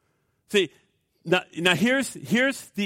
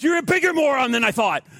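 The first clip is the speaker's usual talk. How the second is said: higher, louder mock jeer; hammed-up scornful put-down; retold insult; 8 semitones higher, 5 dB louder than usual. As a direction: throw it at him like a scornful stranger. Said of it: "He said".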